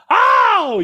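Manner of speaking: a sudden high, loud "oh"; surprised yelp. Real, not acted. Streamer yelps at a chat message, surprised. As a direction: caught off guard, yelp it out.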